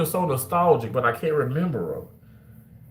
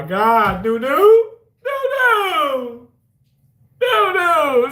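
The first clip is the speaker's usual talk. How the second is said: high, loud wailing of the name Nunu; mock-anguished wail; 12 semitones higher, 6 dB louder, much slower than usual. Real, not acted. Host wailing at a viewer who never ate at Captain D's.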